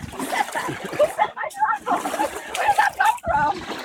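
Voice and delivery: very high, amazed questioning; startled disbelief. Retold or imitated. Retelling her reply to an unseen voice, startled and high. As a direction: squeal the questions in mock astonishment.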